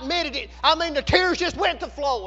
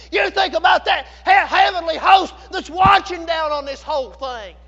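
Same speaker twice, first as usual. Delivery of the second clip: full-force preaching; 5 dB louder than usual. Real, not acted. Preacher assures congregation God never changes, in emphatic full-force preaching. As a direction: drive each declaration home loudly.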